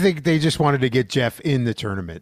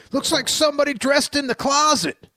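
high, hammed-up announcer impression; imitating J R; 11 semitones higher than usual. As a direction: mimic a gruff old commentator. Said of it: "one of my favorite lines from J R".

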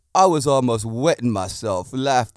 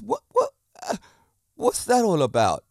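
sudden high squeak of disbelief; baffled; 8 semitones higher than usual. Real, not acted. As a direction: ask it in sharp high-pitched surprise. Baffled by the launch countries.

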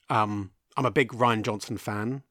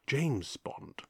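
James Bond; 7 dB quieter than usual. Acted. Host playing Bond's famous introduction for listeners, soft spy voice. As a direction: say it softly, as a suave spy's signature line. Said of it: "we're used to him being Bond".

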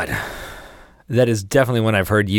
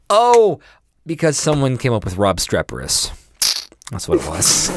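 ordinary talk

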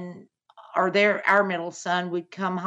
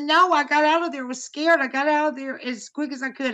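higher and fast, a hint of panic; retelling a scared daughter; 6 semitones higher than usual. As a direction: rush it like the frightened girl escaping. Daughter's scared reply retold.

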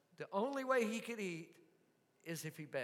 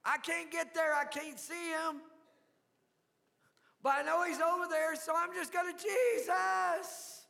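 high, loud pleading, building to a cry; desperate shout; 8 semitones higher, 4 dB louder than usual. Acted. Beggar calling out to unseen Jesus, loud pleading. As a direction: shout because you can't get there. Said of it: "So he started shouting".

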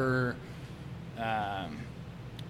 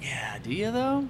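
skeptical; 6 semitones higher, slower than usual. Retold or imitated. Skeptical retort to drinkers.

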